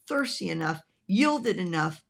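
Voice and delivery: normal talking voice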